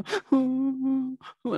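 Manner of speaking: high, drawn-out pretend sob; mock crying wail. Shown as a demonstration. Teacher imitates mood flipping to tears, mock crying. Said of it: "nangis gitu ya".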